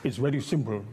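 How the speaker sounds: put-on lightly done Eastern European accent; a hint of a thick Eastern European accent; Kissinger's Eastern European accent. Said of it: "in his thick Eastern European accent".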